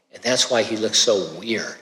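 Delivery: normal talking voice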